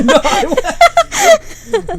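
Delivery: very high, slow, laughing. Real, not acted. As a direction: squeak it out laughing. Laughing reply to plea.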